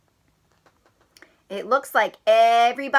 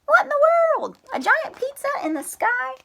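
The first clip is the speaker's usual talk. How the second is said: Froggy voice; 14 semitones higher than usual. Acted.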